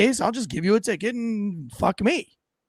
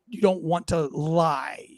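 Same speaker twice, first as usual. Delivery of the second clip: normal talking voice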